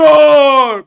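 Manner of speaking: very loud, high startled yell; surprised shout. Real, not acted. Watching the tank get hit, loud shocked shout. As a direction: jolt out a shocked Oh.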